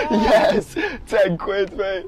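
whooping with real joy; excited. Real, not acted. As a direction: shout it out in triumph. First sale celebration.

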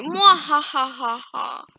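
slow theatrical villain cackle; hammed-up evil mwahaha; evil laugh. Villain laugh after taunt.

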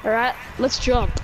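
normal talking voice

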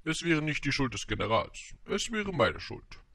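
high-pitched stately royal voice; storybook monarch's elevated lecture; pompous king, reasoning. A narrator's reading.

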